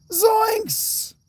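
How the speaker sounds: Zoinks! squeaked very high and loud, cracking at the top; Zoinks shriek. Pretend. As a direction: strain up for a shrieking Zoinks! the way Shaggy would. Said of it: "My voice is shot".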